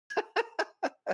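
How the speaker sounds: high-pitched burst of laughter; laughing. Real, not acted. Host amused by the proposition story, laughing. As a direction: crack up at the joke.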